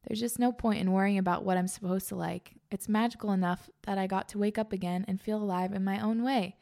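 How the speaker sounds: normal talking voice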